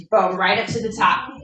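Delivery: ordinary delivery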